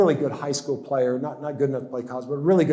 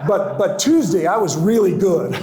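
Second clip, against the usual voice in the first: laughing; 5 semitones higher, 3 dB louder than usual. Real, not acted. Joke about his one-day tryout.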